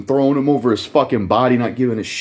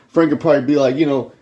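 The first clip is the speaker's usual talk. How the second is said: ordinary talk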